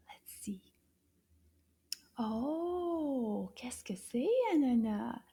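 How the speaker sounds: hushed curious whisper. Acted. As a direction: whisper as if peeking.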